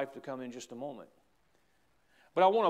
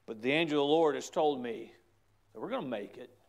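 normal talking voice

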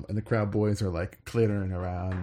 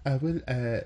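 put-on lightly done French Algerian accent; a touch of a North African French lilt. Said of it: "that's a French Algerian accent".

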